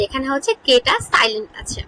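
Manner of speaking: normal talking voice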